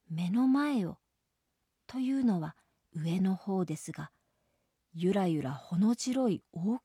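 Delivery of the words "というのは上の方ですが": ordinary delivery, nothing marked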